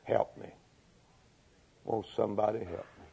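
soft, low, feeble begging; plea to the wind. Acted. Dying leper saying it to the wind, weak hushed plea. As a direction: plead faintly, as if nobody can hear. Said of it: "he was saying to the wind".

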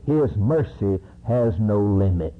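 ordinary delivery

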